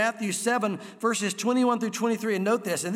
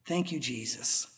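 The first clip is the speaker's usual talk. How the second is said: awed gratitude; 3 semitones lower, 7 dB quieter, much slower than usual. Retold or imitated. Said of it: "watching that going".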